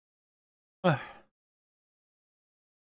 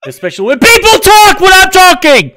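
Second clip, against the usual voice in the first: high, loud screaming rant; hammed-up explosive yell; snapping; 12 dB louder than usual. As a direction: blow up as if out of nowhere. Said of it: "I just fucking snap".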